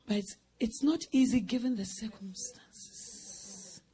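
slow, soft whispered aside; confiding whisper. Real, not acted. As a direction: confide softly and slowly. Preacher drops to confiding tone.

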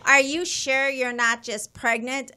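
a hint of the doubting wife; mildly mocking wife asks if she's pregnant; lightly voiced snide wife. Wife's snide question retold.

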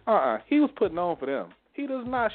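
high mock denial; high-pitched retold contradiction; imitated dismissive mother. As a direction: imitate mom waving it off.

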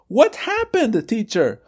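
student. Acted. Student voice in example dialogue.

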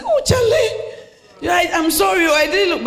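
very high, loud laughing apology; playful high wife's apology; mock apologetic wife.

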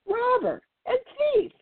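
very high squeaky voice on the insult; high mocking bird voice; mock indignant squawk. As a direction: squeak it out offended. Narrator mimics the insult.

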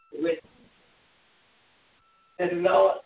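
normal talking voice

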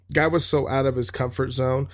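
plain reading voice

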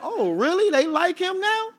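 high, incredulous mock surprise; surprised. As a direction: act stunned and disbelieving. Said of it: "I was like".

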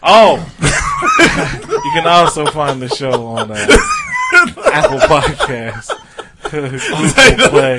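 reading while cracking up; laughing. Real, not acted. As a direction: fight through the laughter, slowly. Reads the platforms while laughing.

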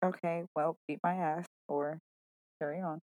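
low-energy, very soft, unimpressed comeback; unbothered dare. Real, not acted. Woman daring her co-host after his objection, quiet and unbothered. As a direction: drop the volume right down and shrug it off.

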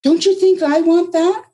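high, loud offended outburst. A narrator's reading. Anna stung by Margaret, offended and high. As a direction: fire it back, stung and high. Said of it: "Anna almost sounded offended".

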